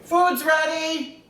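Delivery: loud, slow call across the house; drawn-out call that dinner's ready. Acted. Calling husband from the kitchen.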